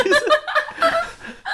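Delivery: squealing high laugh. Real, not acted. Laughing at own joke.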